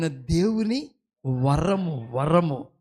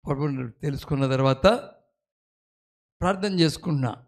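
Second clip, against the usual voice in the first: normal talking voice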